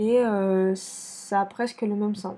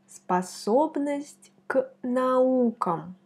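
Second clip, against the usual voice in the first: very slow, every word laid out; slow model repeat; much slower than usual. Shown as a demonstration. Teacher repeating the phrase slowly for learners to copy. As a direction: spell it out slowly for the class. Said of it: "slow model repeat".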